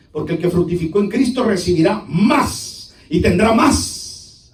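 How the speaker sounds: normal talking voice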